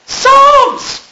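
high, loud disbelieving exclamation; shocked shout of the book's name; indignant cry. Real, not acted. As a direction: throw the word out in outrage. Outraged they throw out Psalms.

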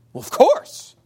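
high, squeaky know-it-all answer; mock eager reply. Preacher imitates a Witness answering, high mock voice. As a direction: toss it off like it's obvious.

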